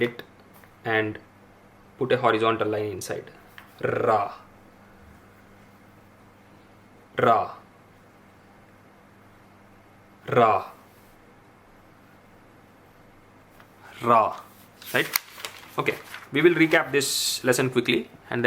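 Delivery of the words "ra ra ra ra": Ra with a strong, pressed R; emphasized R. Shown as a demonstration. Teacher drilling the emphasized Ra for students. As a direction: repeat Ra, leaning on the R. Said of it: "added emphasis on R here".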